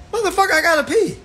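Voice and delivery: high, loud, fast outburst; frustrated yell. Retold or imitated. Worker refused bathroom break, high loud outburst. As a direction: blurt it out in desperation.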